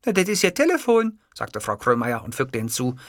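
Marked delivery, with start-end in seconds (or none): 0.1-1.2 high-pitched secretary voice. A narrator's reading. Secretary asking her boss about a ringtone, high character voice.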